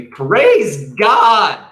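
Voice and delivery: loud, high burst of praise; joyful praise. Acted. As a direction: throw up both arms and rejoice. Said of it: "raising both arms classically".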